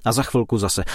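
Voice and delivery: usual speaking voice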